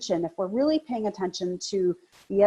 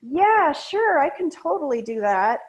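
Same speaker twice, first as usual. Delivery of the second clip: reluctant yes; 6 semitones higher, 5 dB louder than usual. Shown as a demonstration. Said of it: "If she's saying".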